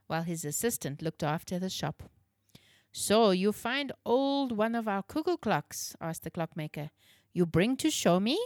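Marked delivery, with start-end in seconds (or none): 2.9-6.0 put-on slow, curious German accent; old clockmaker asking, German accent. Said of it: "Herr Gutmann had a heavy German accent". 7.4-8.4 put-on eager question, German accent. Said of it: "Herr Gutmann had a heavy German accent".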